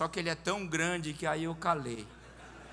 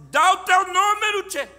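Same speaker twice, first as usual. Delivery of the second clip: full-volume high yell, insistent; imitated gaucho's demand; 14 semitones higher, 16 dB louder than usual. Man overriding the preacher's refusal, insistent high shout. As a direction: cut in with a huge bellow. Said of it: "ele disse".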